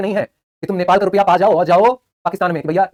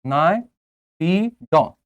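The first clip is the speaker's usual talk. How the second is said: drawn-out drill; much slower than usual. Shown as a demonstration. Teacher drilling a capital name.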